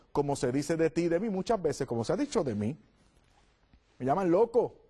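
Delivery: ordinary talk, nothing marked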